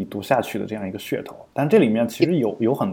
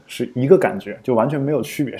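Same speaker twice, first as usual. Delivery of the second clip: ordinary delivery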